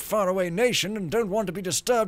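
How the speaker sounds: loud, high self-important priest; pompous priest note. Pretend. Gamer reading the priest's door sign, pompous voice. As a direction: announce it with lofty arrogance.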